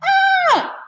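sudden high yelp of fright; Red shrieks in panic; scream. A narrator's reading. Red yelping at the squirrel, high shriek. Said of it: "Red screamed".

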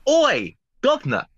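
loud, high mock Cockney shout; Cockney accent. Showing off a Cockney accent to the party guests. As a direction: hail them like a London street geezer. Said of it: "do you want me to do my".

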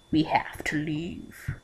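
slow, low whisper; grave, drawn-out murmur; hushed. Acted. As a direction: break the silence very softly. Someone breaks a horrified silence.

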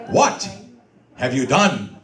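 actor's declamation with a dramatic pause; Shakespearean line demonstration. Shown as a demonstration. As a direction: coach showing how to declaim it. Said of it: "Say it like that".

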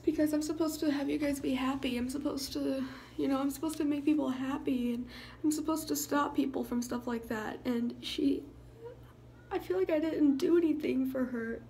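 choking back real tears through the words; crying. Real, not acted. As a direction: voice breaking while crying. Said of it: "I got tears, like, right here".

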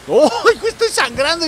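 put-on high, loud cry of a hurt little girl; mock hurt girl. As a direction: whine like a bleeding kid. (niña herida exagerada, aguda)

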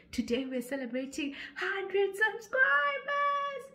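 high, slow, drawn-out celebration; excited announcement. Real, not acted. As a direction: announce the milestone with joy.

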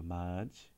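맞 with a barely released J at the end; subtle J sound. Shown as a demonstration. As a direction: say 맞, ending on a subtle J. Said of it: "It's very subtle".

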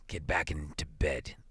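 low, soft, uneasy husband; subdued, uncertain order; unsteady. A narrator's reading. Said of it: "he said unsteadily".

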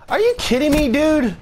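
very high, loud screech of disbelief; high-pitched gamer rage; outraged screech. Real, not acted. Outraged at the game.

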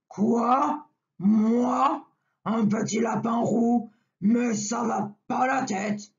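grumpy big brown bear; offended bear, building to a shout. Acted. Angry bear voice. (voix d'ours bougon)

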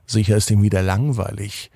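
ordinary delivery

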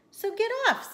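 high, loud story-character yell; indignant order. Acted. Rat ordering the cat off its mat, indignant order.

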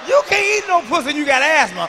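usual speaking voice

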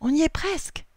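high-pitched, eager announcement; excited announcement. A narrator's reading. Noah updates cousins nearing the rock, excited announcement. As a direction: announce it up high, nearly there. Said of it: "annonce Noah".

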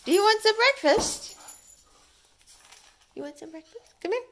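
high, loud sing-song to a dog; dog talk. Real, not acted. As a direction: coax the puppy up high. Coaxing a dog to eat.

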